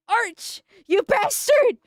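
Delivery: high, loud mock yell of betrayal; mock outrage. Streamer retelling yelling at Arch, high loud mock outrage. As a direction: shriek his name like you've been betrayed in jest. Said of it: "I was like".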